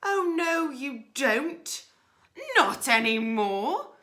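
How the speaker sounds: loud, high mocking rat; loud gloating robber rat; loud declaration. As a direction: crow it triumphantly.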